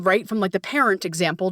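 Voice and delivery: ordinary delivery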